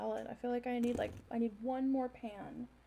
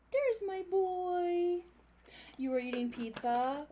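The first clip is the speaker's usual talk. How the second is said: talking to a dog; 9 semitones higher, 4 dB louder, slower than usual. Real, not acted. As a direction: stretch it out for the dog. Greeting dog again.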